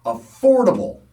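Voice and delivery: loud, high, sarcastic emphasis on "Affordable"; verbal air quotes. Real, not acted. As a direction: set "Affordable" apart with a sharp jump in pitch. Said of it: "I'm doing air quotes".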